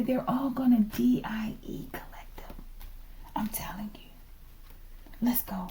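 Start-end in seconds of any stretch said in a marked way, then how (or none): 0.0-4.1 hushed, teasing whisper. Real, not acted. Reader whispering a dark joke to viewers. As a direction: spell it out in a sly whisper.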